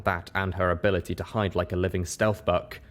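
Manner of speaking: ordinary delivery, nothing marked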